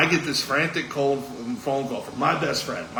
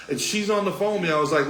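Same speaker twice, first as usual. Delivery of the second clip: ordinary talk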